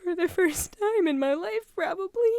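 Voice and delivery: high, wavering on the edge of tears; tearful voice. Real, not acted. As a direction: voice breaking with emotion. Emotional speech breaking into tears.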